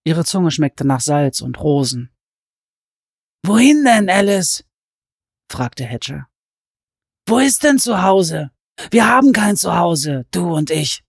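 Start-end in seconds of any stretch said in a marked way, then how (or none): 3.4-11.0 lightly voiced gentle madman; Hatcher voice.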